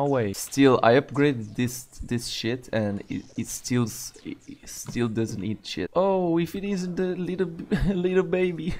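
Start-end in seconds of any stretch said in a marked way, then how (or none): 5.9-8.7 high mocking taunt through laughter; laughing taunt. Real, not acted. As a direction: tease the boss while laughing.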